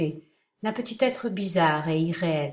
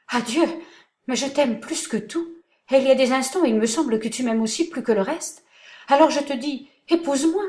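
much higher and louder, pleading declaration; passionate outburst; 7 semitones higher, 7 dB louder than usual. A narrator's reading. Chiffon declares her love to uncle Marc, impassioned. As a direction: pour out her love, nearly crying out.

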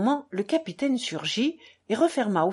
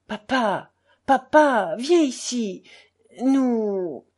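raised, agitated plea that breaks off; overexcited murmur; 4 semitones higher, 5 dB louder, much slower than usual. A narrator's reading. Sick boy calls his father over, overexcited. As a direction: call papa urgently, then run out of strength. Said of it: "murmura Ilyusha surexcité".

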